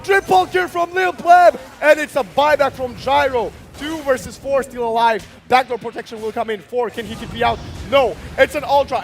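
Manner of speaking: roaring esports caster; fighting game announcer. Real, not acted. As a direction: shout every play as it lands. Live play-by-play hype call.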